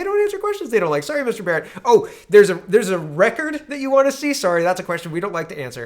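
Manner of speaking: sing-song it to rub it in; taunting government. Acted. Liberals mocking Mister Barrett in a high, loud, sing-song taunt.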